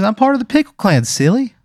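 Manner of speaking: very high, loud and chirpy; a cheerful little pickle introducing himself; squeaky cartoon pickle. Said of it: "He goes".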